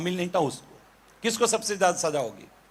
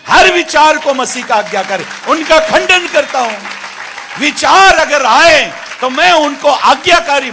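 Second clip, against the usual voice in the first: full-force preaching; 4 semitones higher, 10 dB louder than usual. Real, not acted. Preacher declares every thought captive to Christ, full-force preaching. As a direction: thunder it out to the congregation.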